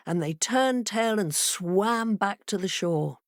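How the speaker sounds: ordinary delivery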